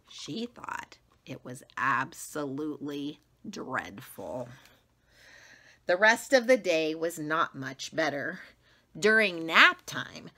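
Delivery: ordinary delivery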